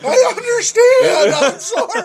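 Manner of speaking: very high, slow squealed apology; hammed-up cowering, laughter in it; mock scolded apology. Scolded teller apologises to the wrestler, high comic squeal. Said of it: "You're being scolded".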